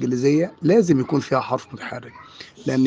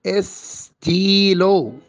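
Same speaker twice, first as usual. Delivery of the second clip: استيلو very slow and higher, "es-tiii-looo"; long ee vowel; 4 semitones higher, much slower than usual. Shown as a demonstration. Teacher modelling the French word, استيلو drawn out slowly. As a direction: stretch the "ee" in استيلو. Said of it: "ولكن ينطق إي".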